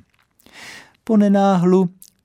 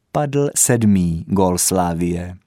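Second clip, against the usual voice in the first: ordinary delivery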